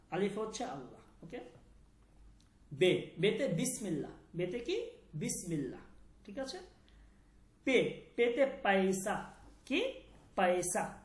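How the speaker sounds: ordinary talk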